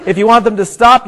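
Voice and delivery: ordinary delivery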